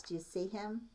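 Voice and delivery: plain reading voice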